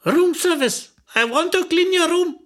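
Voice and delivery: high-pitched English door call; acted chambermaid's high voice; calling maid. A narrator's reading. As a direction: call insistently through the door as room service. Maid calls in English through door.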